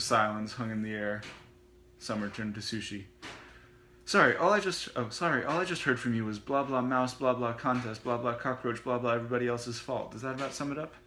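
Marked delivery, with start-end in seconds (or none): 4.0-10.9 mock her sister's rant; sarcastic. Summer mocking Sushi's complaints, sarcastic.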